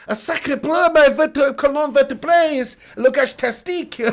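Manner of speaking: put-on laughing hammy French accent; mock French chef, giggling.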